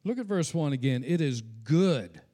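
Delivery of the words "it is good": slow, with weight landing on good; good held long and emphatic; stress on good. Shown as a demonstration. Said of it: "I emphasize that word".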